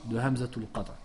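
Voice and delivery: ordinary talk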